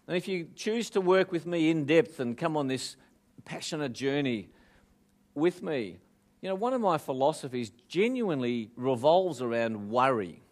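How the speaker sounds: ordinary delivery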